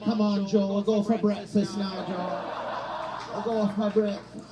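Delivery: high, slow, patient nurse voice; coaxing caregiver. Retold or imitated. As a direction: coax him along like a confused patient. Kamala coaxing Biden like a nurse.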